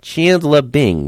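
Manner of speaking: Janice voice. Retold or imitated. Imitating Janice's nasal voice.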